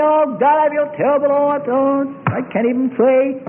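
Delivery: high, whiny mock complaint; mock moaning believer. Preacher mocking a discouraged churchgoer, high and whiny. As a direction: whine it like a worshipper who has given up.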